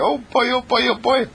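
put-on high, giddy oh boy; gleeful. Speaker cheering about happy faces, high and giddy. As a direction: bounce with glee.

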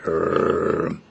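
low growling rumble; dog growl. Retold or imitated. Old dog growls Rex away at the vet, low growl. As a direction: finish with a grudging growl. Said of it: "the other dog looks at Rex and said".

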